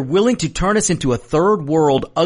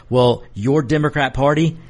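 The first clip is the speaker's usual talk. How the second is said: ordinary talk, nothing marked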